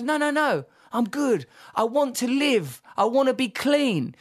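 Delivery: mock the friend's bright determination; Pete insisting. Friend quoting Pete's upbeat resolve, high and emphatic.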